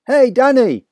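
high, loud excited shout; father shouting to son. A narrator's reading. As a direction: shout across the trees. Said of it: "he shouted".

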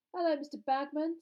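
loud, high cheery greeting; bright. A narrator's reading. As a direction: pipe up brightly and boldly. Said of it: "said Fred brightly".

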